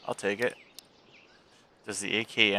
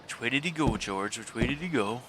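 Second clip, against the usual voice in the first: Looney Tunes cartoon voice; 2 semitones higher, 3 dB quieter than usual. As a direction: play a dopey cartoon brute. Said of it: "Anybody else watch Looney Tunes?".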